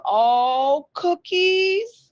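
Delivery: high, drawn-out delighted squeal of words; delighted surprise. Real, not acted. Host being handed cookies on air, delighted.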